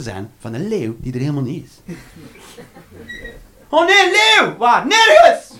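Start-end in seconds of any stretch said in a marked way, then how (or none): 3.7-4.9 high, loud fake alarm; mock panic. Mock panic at imaginary lion. 4.9-5.5 high, loud comic yell; shouted punchline. Acted. Mock answer, shouted.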